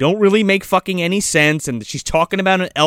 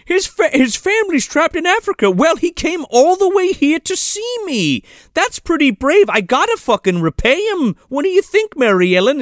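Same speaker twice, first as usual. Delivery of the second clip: higher, earnest voiced Santa; high mock Santa; 10 semitones higher than usual. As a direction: play Santa talking himself into it. Voicing Santa's reasoning to fairy.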